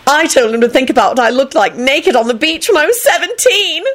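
high, giddy boasting; gleeful high-pitched outburst; happy shout. A narrator's reading. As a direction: crow it, pitch soaring. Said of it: "Elizabeth shouted".

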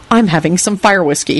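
ordinary delivery, nothing marked

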